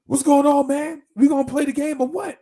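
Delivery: mock rowdy shout; boisterous friend. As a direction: barge in hollering like you own the room. Huge friend barges in.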